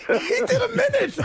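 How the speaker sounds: high, laughing shout; cracking up in disbelief; laughing punchline. Real, not acted. Laughing punchline of his story.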